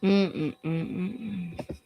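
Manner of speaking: normal talking voice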